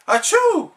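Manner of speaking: sudden loud high sneeze. Acted. SpongeBob sneezing while arguing with Patrick. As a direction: sneeze cuts off the sentence.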